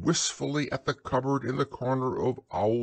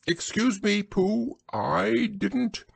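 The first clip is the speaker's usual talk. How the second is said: high-pitched owl protest; high, put-out storybook owl; indignant owl; 11 semitones higher, slower than usual. A narrator's reading. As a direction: deny it in a high voice. Owl denies sneezing.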